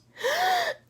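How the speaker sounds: high sharp gasp; shocked gasp. A narrator's reading. Mama Bird seeing her babies hanging, high gasp. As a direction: gasp in alarm. Mother bird sees danger.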